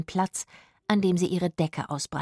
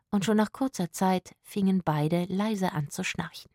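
usual speaking voice

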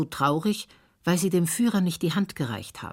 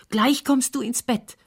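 mother's high-pitched call; 9 semitones higher, 4 dB louder than usual. A narrator's reading. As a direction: call it out high, as a scolding order. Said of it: "ruft Frau Siljas". (hoch und energisch rufend)

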